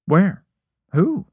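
high, loud questions; mock innocent. A narrator's reading. Friend feigns ignorance of the girl, innocently. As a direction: ask in a high, wide-eyed innocent lilt.

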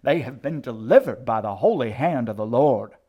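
very loud, ringing proclamation; fervent, swelling pulpit cry; revival preacher. A narrator's reading. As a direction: proclaim deliverance to the whole congregation. Old woman preaches deliverance.

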